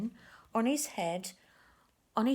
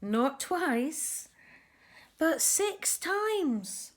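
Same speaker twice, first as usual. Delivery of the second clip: six times stretched out, slow and much higher; stress on six times; 8 semitones higher, 4 dB louder, much slower than usual. A narrator's reading. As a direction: land "six times" slowly as the big reveal. Building up the punchline for children.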